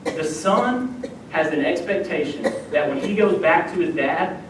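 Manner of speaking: ordinary talk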